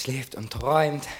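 normal talking voice

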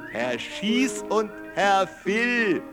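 ordinary delivery

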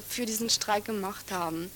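ordinary talk, nothing marked

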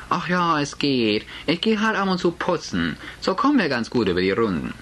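Mutter, höher, beschwichtigend gespielt. Acted. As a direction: spiel die Geldsorgen leicht herunter. Mutter spielt Lage herunter. (Mutter beschwichtigt Richard, hoch und bemüht locker)

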